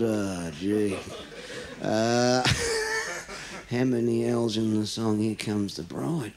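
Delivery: puzzled mumble. Acted. Aussie pondering question.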